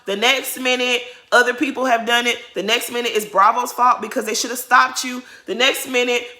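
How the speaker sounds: ordinary talk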